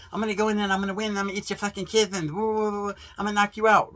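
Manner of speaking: imitating a raging boxer, rushing the threats; Tyson-style boast. Mimicking Mike Tyson's pre-fight ranting, fast and hyped. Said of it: "wasn't like Tyson".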